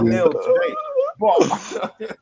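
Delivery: squeaky laugh through the words; laughing in disbelief. Real, not acted.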